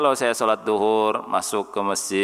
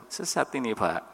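gentle; 8 dB quieter than usual. Shown as a demonstration. Preacher showing how he gently told a man, gentle. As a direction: break it to him softly. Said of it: "dengan lembut saya bilangnya".

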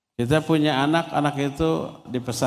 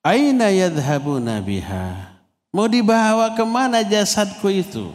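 soul crying out; 6 semitones higher than usual. Retold or imitated. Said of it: "si ruh berteriak". (seruan ruh yang pelan dan sedikit meratap)

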